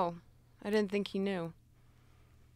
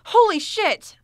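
high, very loud startled cry; shocked outburst; 7 semitones higher, 12 dB louder, slower than usual. Acted. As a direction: blurt it out in shock. Sees a creature killed.

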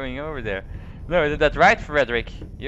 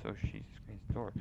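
usual speaking voice

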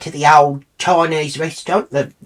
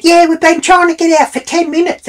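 high whiny imitation of the woman; a shrill-pitched mock of the driver; mocking her voice; 13 semitones higher, 4 dB louder than usual. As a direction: mimic her squeaky complaint. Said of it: "She said".

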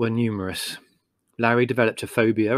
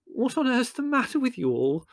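high, imploring lady's voice, not raised; Mother; 13 semitones higher than usual. Acted. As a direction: plead in a high voice amid the uproar. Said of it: "Mother kept imploring".

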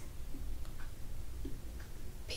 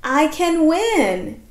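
higher and louder, slow; excited; 5 semitones higher, 5 dB louder, slower than usual. Shown as a demonstration. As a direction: make it sound exciting. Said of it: "Exclamation point".

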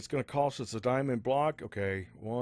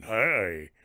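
low scary voice; 5 semitones lower than usual. Shown as a demonstration. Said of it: "scare people".

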